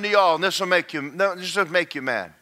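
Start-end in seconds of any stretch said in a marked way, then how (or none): none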